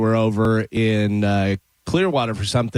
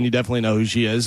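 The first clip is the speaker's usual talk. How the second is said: normal talking voice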